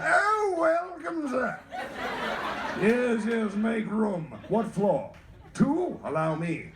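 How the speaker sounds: high-swooping old British gentleman, hammed up; old British guy. Kid acting as elevator mayor welcomes a rider, hammed-up old British accent. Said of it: "sounded like an old British guy".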